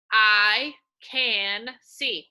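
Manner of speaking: very slow, loud I can see; slow choral read. Shown as a demonstration. Teacher leading the class in reading, very slowly and loudly. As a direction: say each word slowly and clearly for the class.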